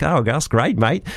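put-on a light, half-hearted Australian accent. Telling the radio host what the Australians said, in a mild Australian accent. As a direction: toss it off in a quick Aussie twang. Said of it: "is that your best Australian accent".

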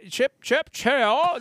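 mock English accent; British lilt rising high on "cheerio"; Mary Poppins. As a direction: play at a prim English nanny. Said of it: "channel my inner, uh, Mary Poppins".

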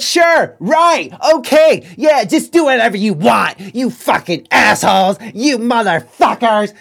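high, loud mock tirade; mad yelling. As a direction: imitate a woman blowing up, punching each word. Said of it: "get mad and start yelling".

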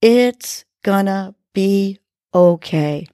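slow, smiling affirmation; smiling and believing. Shown as a demonstration. Host guiding listeners, slow smiling affirmation. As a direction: say it slowly with a smile, believing it. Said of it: "smiling and believing".

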